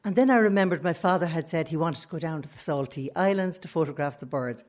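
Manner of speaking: ordinary talk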